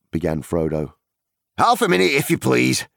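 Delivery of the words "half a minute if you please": high, loud call from a busy landlord; hurried shout. A narrator's reading. Bustling landlord putting off a new guest, shouted. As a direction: call it out over your shoulder. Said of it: "shouted the man over his shoulder".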